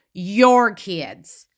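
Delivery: "your" drawn out and hit hard; stress on YOUR. Retold or imitated. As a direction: lean pointedly on "your", then drop on "kids". Pointedly repeats Robin's choice of words.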